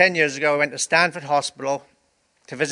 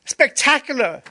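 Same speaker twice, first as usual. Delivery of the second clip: much higher, louder delighted repeat; excited exclamation repeated; 10 semitones higher, 5 dB louder than usual. Retold or imitated. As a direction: shout it again with wonder. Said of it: "he said".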